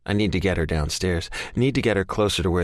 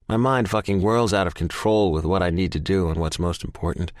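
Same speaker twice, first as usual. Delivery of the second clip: usual speaking voice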